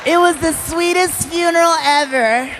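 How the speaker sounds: high, hammed-up funeral wailing; comic high-pitched grieving; mock tearful mourner. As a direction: pretend to weep through a eulogy.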